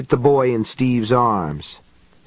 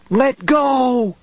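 put-on high, desperate boy's scream, higher; 13 semitones higher than usual. A narrator's reading. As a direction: scream it like a frantic child fighting free. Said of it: "he screamed".

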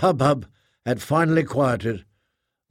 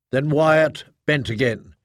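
ordinary delivery, nothing marked

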